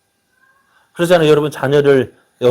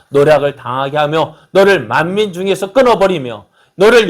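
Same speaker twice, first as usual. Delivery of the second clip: louder solemn scripture reading; 4 semitones higher, 6 dB louder than usual. Acted. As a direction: proclaim the Lord's words with weight.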